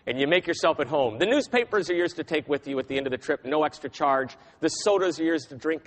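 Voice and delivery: a hint of the deep Texan cab driver. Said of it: "I won't get as low as he can go".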